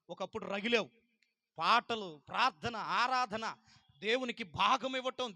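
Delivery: loud, building preacher's cry; fiery preaching. Acted. Pastor rebuking lapsed believers, in a loud building preach. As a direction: stir them up phrase by phrase.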